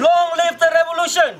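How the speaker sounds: high, loud rally chant; fiery revolutionary speech. Real, not acted. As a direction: chant it out to the crowd. Leads protest chant call.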